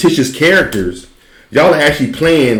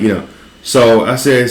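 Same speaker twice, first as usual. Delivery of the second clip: ordinary talk, nothing marked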